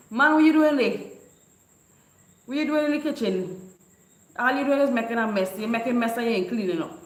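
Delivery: high, loud scolding mama; scolding mother. Retold or imitated. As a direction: tell him off for messing up the kitchen. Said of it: "Mama can say".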